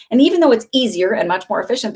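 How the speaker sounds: ordinary talk, nothing marked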